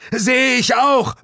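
high, loud, slow shout; the hero bellows back to Suko in battle; roaring back. Acted. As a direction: bellow it over the chaos. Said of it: "brüllte ich".

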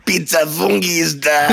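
high mock French-accented delivery; fake French accent. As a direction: imitate a pizza guy in fake French. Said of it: "pseudo-französische Akzent".

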